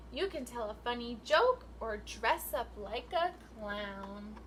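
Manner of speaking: sing-song picture book read, rising and falling; reading to children. Acted. Teacher reading a rhyming book to her class, sing-song. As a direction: read the rhyme out to the class with lilt.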